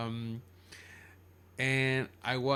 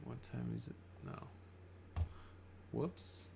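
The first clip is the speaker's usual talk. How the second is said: whispering; 11 dB quieter, slower than usual. Real, not acted. Checking the time, muttering aside.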